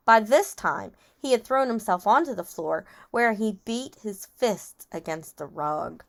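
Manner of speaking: usual speaking voice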